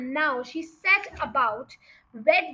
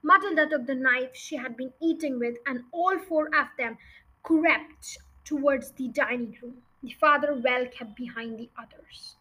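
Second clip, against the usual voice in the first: usual speaking voice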